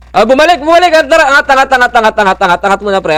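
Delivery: high, loud urgent warning; alarmed callout. Real, not acted. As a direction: shout the team upward urgently. Urgent call as enemy returns.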